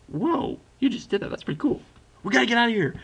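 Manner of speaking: impressed Carver. Retold or imitated. Carver impressed then urging escape.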